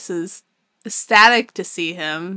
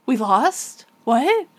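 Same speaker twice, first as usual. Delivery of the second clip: baffled, higher rise on What; mock confusion; 5 semitones higher, slower than usual. Soldiers hearing they lost, mock confused. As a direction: imitate stunned disbelief, pitch jumping up. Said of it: "they're, like, so confused".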